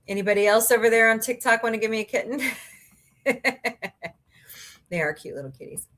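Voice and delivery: usual speaking voice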